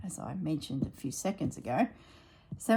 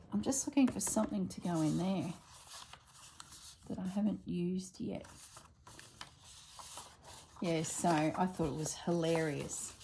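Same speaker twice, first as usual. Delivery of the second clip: ordinary talk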